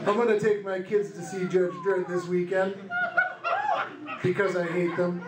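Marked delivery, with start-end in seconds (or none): none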